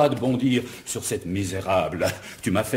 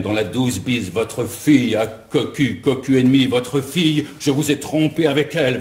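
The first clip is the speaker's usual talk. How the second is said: ordinary talk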